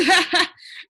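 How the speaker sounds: high, loud whooping laugh; siren-like laugh. A narrator's reading. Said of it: "a laugh that sounded like several sirens".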